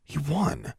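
soft, high, puzzled thought; wondering to herself. A narrator's reading. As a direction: puzzle over it under your breath. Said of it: "Alexandra wondered".

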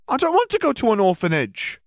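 put-on very high little-girl voice; protesting child. A narrator's reading. Hermione hearing of orphanages, high childish protest.

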